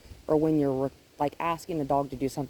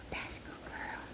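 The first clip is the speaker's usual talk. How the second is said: whispered praise for the dog; whispering; 15 dB quieter than usual. Real, not acted. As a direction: breathe it softly to her. Soothing the dog softly.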